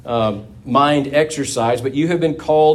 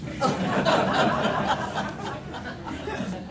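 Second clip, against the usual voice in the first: airy high laugh at his own punchline; real laughter after the joke; breathless laugh; 14 semitones higher, 8 dB quieter than usual. Real, not acted. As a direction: let the laugh spill out unvoiced.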